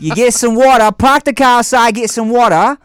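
loud, high British-Boston mash-up as Australian; Australian accent attempt. Shown as a demonstration. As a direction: belt out a bad Aussie accent. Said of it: "an equation for Australian accent".

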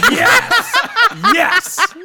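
high laughing cheer. Real, not acted. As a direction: yell it out in triumph. Cheering wife's reply.

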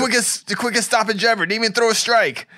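high, loud hyped-up quote; excited boasting. Acted. Host mimicking promoter tweeting a broken leg, excited. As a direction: crow it like a thrilled promoter. Acts out an excited video caption.

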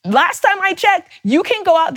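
heated, pointed challenge; rising frustration breaking through; getting pissed. Real, not acted. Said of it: "if it sounds like I'm getting pissed".

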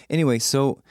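normal talking voice

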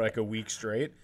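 ordinary talk, nothing marked

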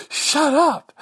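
high, sharp yell at the machine; a sudden high comic shout; mock shout. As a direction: snap at the machine to shut up. Yelling at the karaoke machine.

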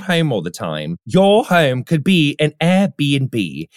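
put-on posh British accent for an ad read. Host reads Airbnb ad to listeners in British accent. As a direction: play it as a mock-British host selling Airbnb. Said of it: "I was doing my British voice earlier".

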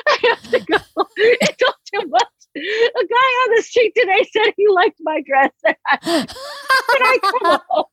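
very high, teary, whimpering voice; whiny crying self-parody. Retold or imitated. Young woman crying to her parents on the phone, high teary whine. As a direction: wail it like an overwhelmed kid begging to leave. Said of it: "I was like".

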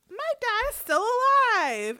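put-on high little-boy protest; retold child's protest. Little Luke answering Aemond's insult, high-pitched mock protest.

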